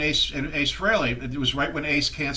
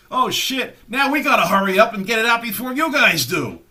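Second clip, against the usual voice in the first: higher, louder flustered outburst; retold panic; 6 semitones higher, 6 dB louder than usual. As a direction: act out a manager suddenly in a rush. Manager panics at being beaten.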